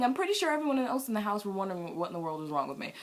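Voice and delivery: ordinary delivery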